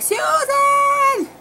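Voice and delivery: high, loud imitated scream; screaming for someone. Nurse calling the midwife in from down the hall, screaming. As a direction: yell the name as a drawn-out panicked shriek. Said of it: "screaming for my midwife".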